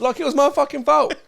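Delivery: high, loud outraged protest; loud mock indignation; indignant. Real, not acted. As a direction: act wronged and amused. Indignant at friend's accusation.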